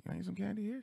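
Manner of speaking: small high voice, asking mom; pleading kid. Retold or imitated. Child begging mom for candy in the checkout line, pleading.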